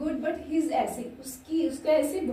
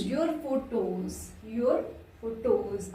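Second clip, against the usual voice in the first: slow dictation; much slower than usual. Shown as a demonstration. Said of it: "slow dictation of example".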